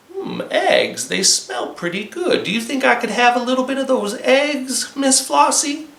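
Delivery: greedy fox voice. Acted. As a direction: wheedle for the eggs.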